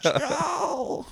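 high eerie ghostly wail. Retold or imitated. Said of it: "I also had a weird voice set for my thing, and so it sounded like a ghost".

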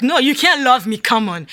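loud protest. Retold or imitated. Telling God he cannot love her, an outburst of disbelief. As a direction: push back hard, you can't accept it.

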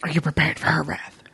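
hushed teasing whisper. Real, not acted. Teasing her co-host about the wrath, whispered. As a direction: breathe it like a secret.

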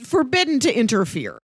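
ordinary talk

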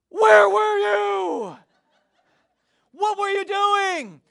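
high, drawn-out full shout; acted frantic scolding. Family confronts the boy who was found, acted frantic scolding. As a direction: re-enact relief exploding into a slow, huge yell.

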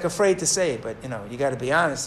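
normal talking voice

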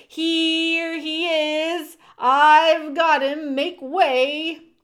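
slow, high, loud announcement; calling out. A narrator's reading. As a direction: holler over a crowd, words drawn out. Furlow announcing his captive to crowd.

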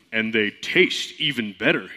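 Toad voice. Acted. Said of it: "Toad has to have a lower voice".

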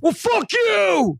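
mock angry rant. Playing a fuming Houston fan, mock shouting. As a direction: fake a furious outburst, shouting slow. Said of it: "his joking, anticipated response".

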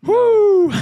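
high loud whoop; excited whoop. Real, not acted. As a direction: hype yourself up. Hyping up pre-workout.

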